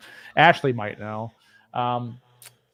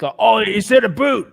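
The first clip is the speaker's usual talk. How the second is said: higher, slow mocking quote; mimicking others; 8 semitones higher, 7 dB louder, slower than usual. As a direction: imitate people accusing him of saying aboot. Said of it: "but people think".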